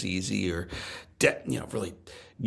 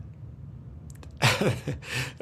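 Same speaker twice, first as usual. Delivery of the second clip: soft laugh; 4 semitones higher, 4 dB quieter than usual. Real, not acted. Emotional laugh after father's words.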